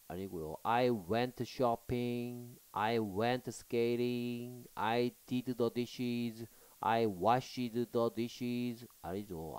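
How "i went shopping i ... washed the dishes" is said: very slow reading. Shown as a demonstration. Teacher reads options very slowly.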